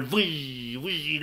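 whooshing wind imitation; howling wind noise. Storyteller imitates the wind answering Scott, whooshing. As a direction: blow two long gusts with the voice. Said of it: "Și vântul".

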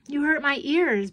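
put-on high-pitched little-boy complaint; complaining. A narrator's reading. Little brother in a noisy car, complaining. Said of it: "Brian complained".

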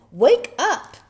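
high, loud shout; frightened cry. A narrator's reading. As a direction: cry out in alarm. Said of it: "he cried".

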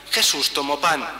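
normal talking voice

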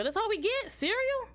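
mock outrage, high and squeaky; incredulous. Real, not acted. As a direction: squeak out Cereal like it's an insult. Disappointed at only cereal.

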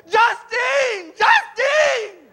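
high, loud, drawn-out plea; hammed-up pleading shout; begging wail. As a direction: wail the name like a lovesick beggar. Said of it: "he models for him what begging is".

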